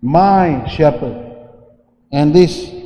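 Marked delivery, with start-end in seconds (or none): 0.0-1.3 My drawn out slowly and leaned on; stress on MY. Shown as a demonstration. Preacher stressing My to the congregation, slow and firm. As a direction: hit the word My, then ease into shepherd. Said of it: "Underline the word my".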